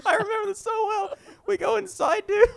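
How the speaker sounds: talking high through laughter; can barely get it out for laughing; real laughter breaking into the words. Real, not acted. Laughing while retelling story.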